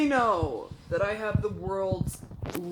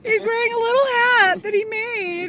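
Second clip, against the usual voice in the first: much higher, louder gushing; delighted; 9 dB louder than usual. Real, not acted. Showing viewers the little hat Brandon made, in a much higher delighted gush.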